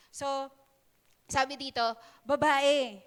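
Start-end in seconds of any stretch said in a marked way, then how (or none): 2.3-3.0 a blunt, louder "Babae" with a falling end; disrespectful "Babae", barked out; rude address. Shown as a demonstration. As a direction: call her "Babae" like a put-down. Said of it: "Hindi, hindi, hindi ganun".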